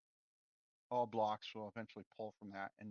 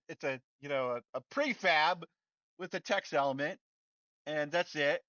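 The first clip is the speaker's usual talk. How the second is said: worked-up rant; 7 semitones higher, 8 dB louder than usual. Real, not acted. Ranting at Unity's error screen.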